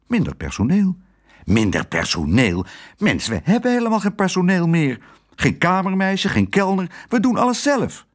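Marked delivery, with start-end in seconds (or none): 1.4-5.0 incredulous repeat, then jumping high; indignant echo. A narrator's reading. Husband indignantly echoes his wife. 5.3-8.1 high-pitched indignant listing; exasperated. A narrator's reading. Exasperated husband lists missing staff.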